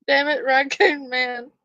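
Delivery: laughing. Real, not acted. Laughing fit continues.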